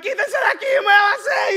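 high, loud panicked yelling; panicked yell in Spanish. Retold or imitated. Boy with no brakes yelling at the crowd in panic. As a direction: scream at people to get out of the way. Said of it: "I'm yelling in Spanish".

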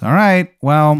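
high, loud bad Maryland accent; Wizard of Baltimore. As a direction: concede loudly in a put-on Maryland accent. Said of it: "how upsetting this Maryland accent is".

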